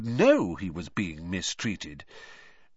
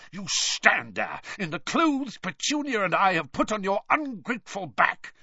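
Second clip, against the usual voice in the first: outraged scolding; 9 semitones higher than usual. A narrator's reading. Uncle berating his nephew over ungratefulness, outraged rant.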